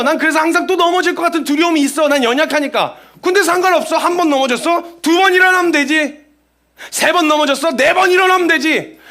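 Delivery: loud, high, impassioned sermon; preaching at full force. Acted. Pastor rallying believers who keep falling, in a loud impassioned sermon. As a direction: preach it with rising fervour.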